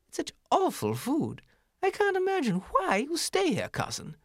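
a prim, high city mouse picking at words; snooty city mouse. Acted. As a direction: turn your nose up at the farmyard. City mouse boasts to cousin.

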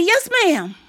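high-voiced obedient child; child's retort. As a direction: answer up high like a little kid. Child answers grandma.

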